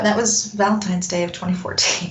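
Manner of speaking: usual speaking voice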